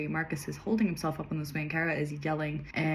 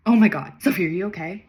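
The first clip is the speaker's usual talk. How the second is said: loud, lightly acted concern; urgent voice; 2 semitones higher, 8 dB louder than usual. A narrator's reading. Friend over the radio checking on Sophie, urgent. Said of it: "an urgent voice came over the radio".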